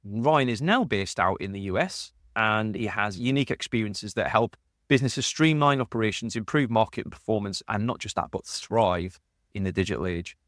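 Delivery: ordinary talk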